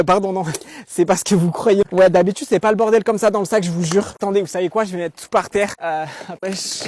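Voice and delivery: usual speaking voice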